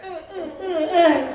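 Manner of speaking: high stuttering uh sounds; mock vibrator moans. Comic pretending a vibrator is in, stuttering high moans. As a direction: fake a buzzing shudder. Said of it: "Sorry, I have one in now".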